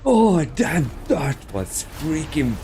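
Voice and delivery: usual speaking voice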